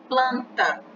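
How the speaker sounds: planta with P and L both sounded; clear consonants. Shown as a demonstration. Teacher to students, planta with every consonant clear. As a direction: let the P L in planta ring out. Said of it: "a gente escuta o P L".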